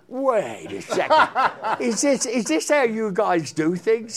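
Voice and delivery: put-on stunned young singer laughing at the rush; laughing disbelief. Young singer to Stu, laughing disbelief. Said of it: "I said".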